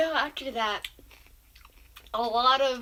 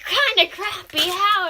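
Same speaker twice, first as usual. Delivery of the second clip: disbelief; 7 semitones higher, 9 dB louder, slower than usual. Retold or imitated. Friend calls the shirt crappy.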